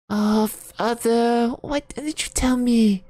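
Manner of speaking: naive murmur. A narrator's reading. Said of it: "she murmured naively".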